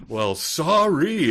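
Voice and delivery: loud, high, drawn-out sarcastic sorry; huffy mock apology. Rebuffed meat guy packing up his stuff, huffy mock apology. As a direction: stretch it out like an offended salesman. Rebuffed salesman snaps back, retold.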